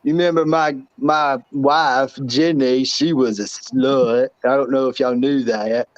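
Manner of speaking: Forrest Gump impression. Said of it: "Life is like a box of chocolates".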